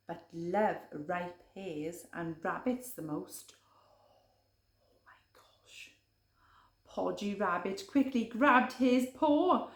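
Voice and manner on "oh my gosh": unvoiced hushed mock shock; whisper. Reader to child at the twist, whisper. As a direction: breathe it in awe. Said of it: "whispered aside".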